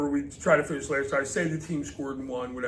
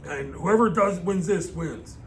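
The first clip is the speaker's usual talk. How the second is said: raised, louder playing-field voice; eager kid bargaining; 5 semitones higher, 4 dB louder than usual. Kid refusing to lose at street football, eagerly bargaining. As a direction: push for it like a kid mid-game.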